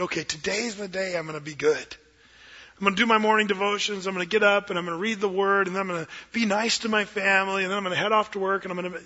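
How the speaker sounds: fast, eager run of good intentions; fast, hopeful list of promises to yourself; eager resolutions. Retold or imitated. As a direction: rattle off your plans for the day. Voicing a resolute believer.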